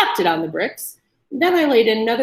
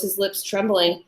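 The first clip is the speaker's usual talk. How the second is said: ordinary delivery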